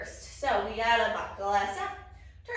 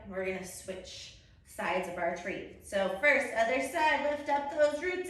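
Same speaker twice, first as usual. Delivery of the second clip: ordinary talk, nothing marked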